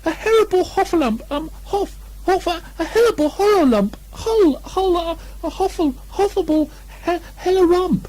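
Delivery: very high panicked cries, tripping over the word; a terrified pig garbling heffalump; high frantic squeal, stumbling in fear; Piglet panicking. A narrator's reading. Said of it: "still crying out".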